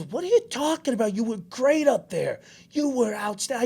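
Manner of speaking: high-pitched imitation of dad's protest; disbelieving dad. Dad praising his son after the confession, acted disbelief. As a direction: reassure in mock incredulity.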